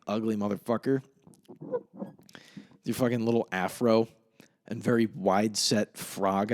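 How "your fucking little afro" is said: usual speaking voice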